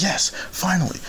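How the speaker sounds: high, triumphant exclamation; acted high cry of relief; relieved excitement. Spots the park at last.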